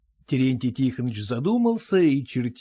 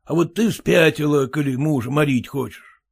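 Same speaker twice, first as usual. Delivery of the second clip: ordinary delivery, nothing marked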